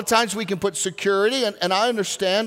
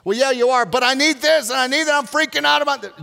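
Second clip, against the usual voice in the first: higher, louder panicked protest; anxious pleading; 6 semitones higher, 4 dB louder than usual. Retold or imitated. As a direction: plead with God, freaking out. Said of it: "I was crying out to God".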